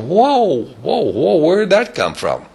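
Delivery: high, loud astonished crowd; high amazed exclamation; amazed whoa. Retold or imitated. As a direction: gasp it out like stunned onlookers. Amazed people quoted.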